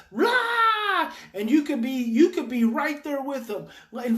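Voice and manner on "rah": high, loud mock roar; imitation of a raging kid's outburst; imitated child's roar. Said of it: "Your child could be doing this".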